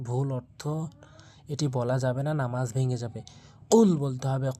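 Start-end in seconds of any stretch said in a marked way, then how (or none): 3.7-4.5 কুল with a hard back-of-tongue k, pushed out; correct pronunciation. Shown as a demonstration. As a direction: punch the কুল from the root of the tongue. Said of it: "কফটা জিহ্বার গোড়া থেকে উচ্চারণ করতে হবে ধাক্কা দিয়ে".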